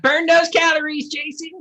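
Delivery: high, peppy workout coach; fitness instructor voice. Retold or imitated. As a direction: cheer him on like Richard Simmons.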